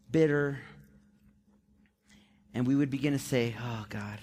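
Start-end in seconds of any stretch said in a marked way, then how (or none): 3.6-4.2 low, soft mock lament; self-pitying. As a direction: quietly play up helpless self-pity. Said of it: "this self-pity of".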